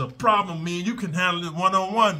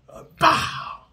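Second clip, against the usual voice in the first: high loud breathy "bow" hit sound; breathy impact shout; 6 dB louder than usual. Shown as a demonstration. Demonstrating the headbutt, high breathy "bow". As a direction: spit out the strike noise.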